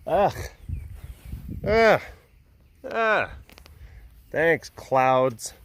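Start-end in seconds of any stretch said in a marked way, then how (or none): 1.7-3.3 frustrated groan. Real, not acted. Annoyed at the clouds.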